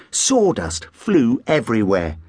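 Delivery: usual speaking voice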